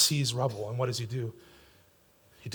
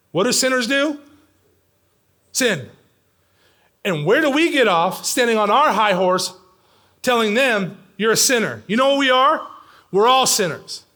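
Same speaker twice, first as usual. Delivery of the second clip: raised, higher preacher's voice; impassioned preaching; 5 semitones higher, 5 dB louder than usual. Real, not acted. Preacher rebukes the self-righteous among his church, impassioned. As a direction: challenge the congregation with force.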